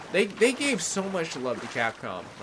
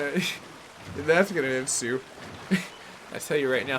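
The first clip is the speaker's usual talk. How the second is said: ordinary talk, nothing marked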